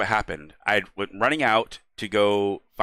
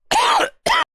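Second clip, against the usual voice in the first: sudden harsh coughing burst; coughing fit; 8 dB louder than usual. Real, not acted. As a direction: cough hard, caught off guard. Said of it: "sorry, had a coughing fit".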